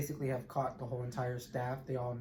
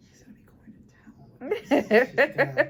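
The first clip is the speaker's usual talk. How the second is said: high laughing squeal; 12 semitones higher, 13 dB louder than usual. Real, not acted. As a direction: giggle it out much higher and louder. Excited laughing about fighting baby.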